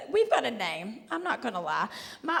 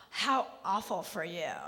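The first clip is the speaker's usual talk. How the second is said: mock pity; 6 semitones lower, 7 dB quieter than usual. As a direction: pity them condescendingly after a gasp. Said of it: "She goes".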